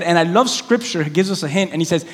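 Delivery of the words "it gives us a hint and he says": ordinary talk, nothing marked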